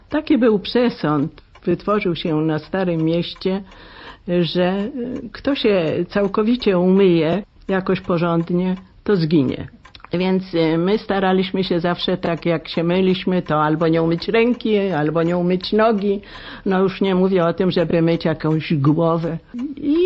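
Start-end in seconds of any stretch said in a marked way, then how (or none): none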